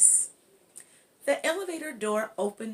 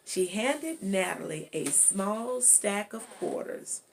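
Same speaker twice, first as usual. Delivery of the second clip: usual speaking voice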